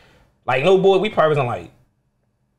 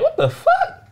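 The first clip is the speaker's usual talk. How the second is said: disbelief; slower than usual. Retold or imitated. Stuck in cell over weekend.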